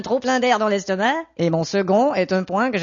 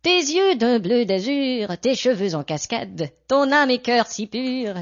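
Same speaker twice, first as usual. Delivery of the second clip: slow, lofty poetry reading; poem recital; 3 semitones higher, slower than usual. Shown as a demonstration. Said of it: "tu veux l'écouter".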